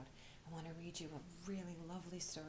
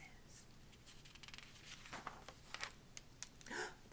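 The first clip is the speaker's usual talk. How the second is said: soft gasp; 10 dB quieter than usual. Acted. Teddy hears rustling noises.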